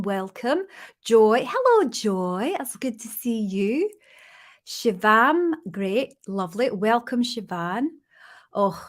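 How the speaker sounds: friendly roll-call in a Scottish accent; put-on Scots accent, chatty and welcoming. Shown as a demonstration. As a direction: greet each guest in your best Scottish accent. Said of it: "I'm gonna do in a Scottish accent".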